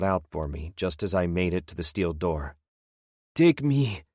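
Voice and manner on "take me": high, loud plea; pleading. A narrator's reading. Old prisoner pleads as the warden leaves, loud high plea. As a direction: beg him not to go. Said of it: "he pleaded".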